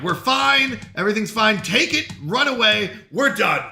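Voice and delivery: usual speaking voice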